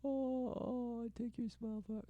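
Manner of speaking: high, soft mock swoon; mock swooning bride. Answering a marriage proposal, mock swooning. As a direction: play a softly overcome bride, hammed up. Voices the bride again, mockingly.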